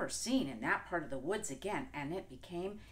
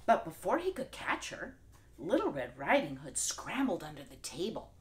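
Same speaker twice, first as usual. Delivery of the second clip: ordinary delivery, nothing marked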